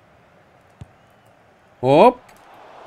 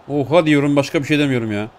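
normal talking voice

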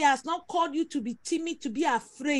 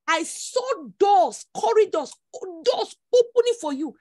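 rising, slow, louder preaching of a vision; prophetic declaration; 4 semitones higher, 5 dB louder, slower than usual. Acted. Prophet to congregant, vision of her lifting, declared slow and loud.

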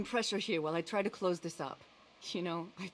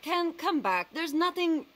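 calling after him; 8 semitones higher, 6 dB louder, slower than usual. Acted. Calls after man storming off.

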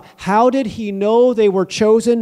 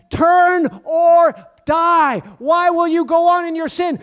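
the word's command; 7 semitones higher than usual. Acted. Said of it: "And it said".

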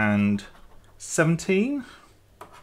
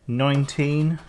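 usual speaking voice

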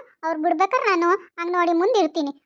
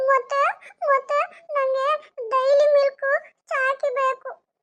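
put-on high, slow, teary little-boy whine; whiny grandson; 8 semitones higher, much slower than usual. Grandson bargaining with grandma for Dairy Milk, high and whiny. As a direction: drag out the demand like a sulking child.

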